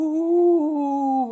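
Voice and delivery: spooky ghostly ooh; ghost sound. Shown as a demonstration. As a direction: moan like a ghost. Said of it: "let me think of my ghost".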